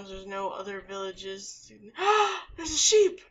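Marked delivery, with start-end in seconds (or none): 1.8-2.5 sharp excited gasp; surprised gasp. Real, not acted. Spots a sheep, gasps. 2.6-3.2 high, loud excited shout. Real, not acted. Excited find in game.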